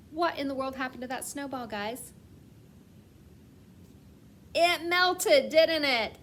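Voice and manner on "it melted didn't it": bright, loud and high answer; excited reveal. Real, not acted. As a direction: announce it with a big teacher reveal.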